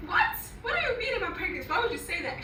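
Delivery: high, fast startled questions; shocked disbelief. Retold or imitated. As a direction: blurt it out in disbelief. Retold shocked reply to friend.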